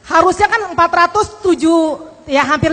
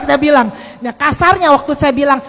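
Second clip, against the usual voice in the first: usual speaking voice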